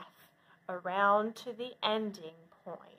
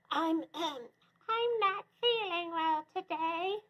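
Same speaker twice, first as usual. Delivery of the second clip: higher, softer put-on old lady voice; wolf faking grandma; 7 semitones higher, 4 dB quieter than usual. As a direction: fake a frail grandmother.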